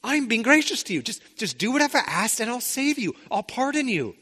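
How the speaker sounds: a light touch of pleading in his voice; king pleading. Retold or imitated. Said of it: "he's pleading".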